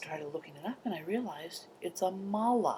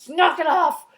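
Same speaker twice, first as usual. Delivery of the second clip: a much higher, louder retold outburst; retold snap; 11 semitones higher, 13 dB louder than usual. Retelling how she yells at her slurping sister, loud mock shout. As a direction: re-enact yelling at the sister.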